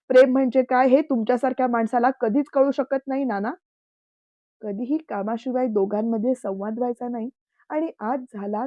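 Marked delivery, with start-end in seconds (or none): 0.1-3.5 angry. A narrator's reading. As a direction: hurl it at the uncle. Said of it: "तोही रागात बोलला".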